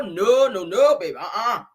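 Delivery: ordinary talk, nothing marked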